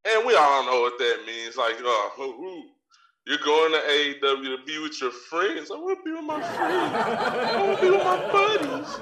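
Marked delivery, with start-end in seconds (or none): 5.7-9.0 high, whiny mock of a wrestler. Mocking Kyle O'Reilly who wants to join his friends, in a high whiny voice. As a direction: sneer at him in a high whine.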